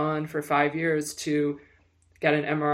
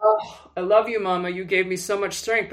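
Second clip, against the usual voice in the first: ordinary delivery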